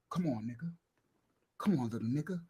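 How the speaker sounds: retold rival taunting, low and soft. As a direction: bait him in a low voice.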